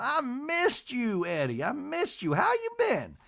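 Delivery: very high, drawn-out mock greeting; Miss Patricia. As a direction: imitate a matron cooing at an old friend. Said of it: "flashback of Miss Patricia going".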